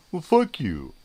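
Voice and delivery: high, loud jeering comeback voice; mocking smart-ass. Mocking a smart-ass commenter, in a high, loud jeering voice. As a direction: sneer it like an online troll. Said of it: "smart-ass remarks like".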